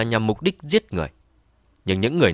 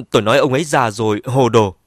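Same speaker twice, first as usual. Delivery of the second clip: louder, forced-out repeat; said loudly; 6 dB louder than usual. Acted. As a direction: say it out loud this time. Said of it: "Dương Đan Ni nói lớn".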